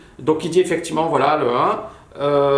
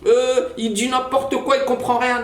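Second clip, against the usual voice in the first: put-on whiny kid voice; 9 semitones higher than usual. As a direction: play a petulant know-it-all kid. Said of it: "beaucoup de petits gamins qui vont".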